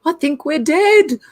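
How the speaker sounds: slow, high stoned mock voice; hammed-up slow, high quote, a laugh breaking in; imitating baked cop. Retelling a baked cop's call to viewers, mock stoned and slow. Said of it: "He said".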